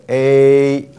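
"A" drawn out slowly as a steady "ei"; long vowel. Shown as a demonstration. Teacher naming the long counterpart, "A" stretched out. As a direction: hold "A" long. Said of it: "相对长母音是ater".

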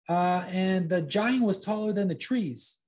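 put-on high, hesitant boy fibbing; nervous little liar; lying puppet boy. A narrator's reading. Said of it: "continued Pinocchio".

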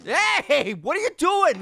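high-pitched indignant call; indignant protest. Pretend. Player telling off the Yeti, high indignant protest.

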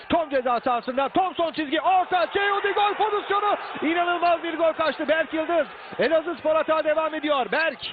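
rising excited play-by-play; screaming football commentator. Real, not acted. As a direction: build to a goal-chance shout. Goal chance commentary builds.

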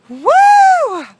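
very high, very loud victory whoop; ecstatic whoop. Real, not acted. Angler landing his biggest bass, ecstatic whoop. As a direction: scream with joy at the catch.

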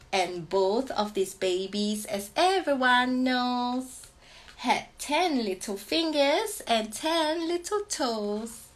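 slow lilting read-aloud, words drawn out; slow sing-song picture-book refrain; sing-song teacher reading. Acted. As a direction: croon it to a baby, teacher-style. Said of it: "such a teacher voice".

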